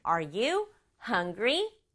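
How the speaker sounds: very slow, each word spaced, rising at the end; slow model question. Shown as a demonstration. Teacher modelling a question slowly for children. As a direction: spell it out slowly for the kids. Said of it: "물어보는 말은".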